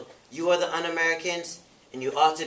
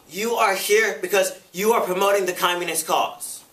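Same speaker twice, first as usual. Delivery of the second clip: higher, forceful accusing voice; heated accusation; 5 semitones higher, 6 dB louder than usual. Acted. Committee member accusing Robeson at the hearing, heated. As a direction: accuse the witness with emphatic force.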